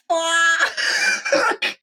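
long, high mock wail; hammed-up high cry of dismay; panicked wail. Storyteller reliving the drain's end underwater, panicked wail. Acting dismay at flooded exit.

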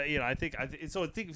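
ordinary talk, nothing marked

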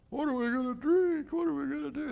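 high, whining cry of worried people; whiny panicked complaining. Acted. The Israelites complaining to Moses about water, high panicked whine. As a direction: wail it like a scared, grumbling crowd.

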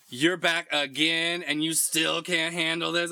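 slow, loud, drawn-out sass; sassy nurse. Retold or imitated. The nurse mocking a regular, slow loud sass. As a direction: tease him slowly like the sassy nurse. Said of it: "she's like".

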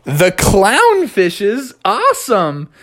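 high, stretched-out amazed frog; delighted Froggy. Acted. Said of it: "And Froggy was like".

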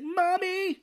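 high, panicked call for mom; high terrified cry. Retold or imitated. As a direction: cry out for mommy. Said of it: "He's like".

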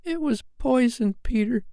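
very high, gentle fairy tone; high, delicate confession; soft fairy voice. Acted. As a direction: tell him softly in a tiny voice. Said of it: "she told him softly".

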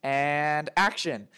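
rally the set, then draw out the cue; director calling the set. Acted. Director readies cast and crew for a take, calling out. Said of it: "The director is like".

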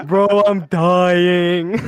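breathless, drawn-out laughter; gasping through a laugh. Real, not acted. Laughing at the joke.

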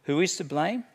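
ordinary talk

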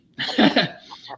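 a real unvoiced burst of laughter; breathy laugh. Real, not acted. Storyteller laughing about the FBI director, airy laugh. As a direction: laugh out at the absurdity.

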